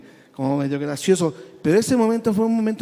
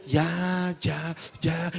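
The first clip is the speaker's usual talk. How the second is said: soft hushing "ya, ya", trailing off quieter; soothing a baby; 6 dB quieter, much slower than usual. Retold or imitated. Big brother hushing a sobbing man like a baby. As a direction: comfort him like a crying baby. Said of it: "como si yo fuera un bebé".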